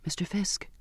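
soft, hesitant near-whisper. A narrator's reading. Passenger timidly trying to get the cook's notice, whispered. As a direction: call his name softly, nearly whispering. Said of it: "I whispered".

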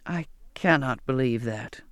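low, slow, understated disbelief; disbelieving exclamation. A narrator's reading. Zachariah hears the crew blames Charlotte, mildly disbelieving. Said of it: "he exclaimed".

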